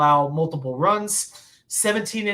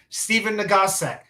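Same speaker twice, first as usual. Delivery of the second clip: ordinary talk, nothing marked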